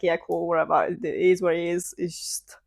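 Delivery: low, casual verbal shrug; resigned acceptance. Retold or imitated. Retold resignation to the house.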